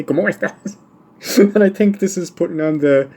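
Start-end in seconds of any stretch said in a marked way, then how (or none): 0.0-0.8 high, hammed-up bandit-movie Mexican accent on "¿Cómo estás?". Shown as a demonstration. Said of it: "I have to say".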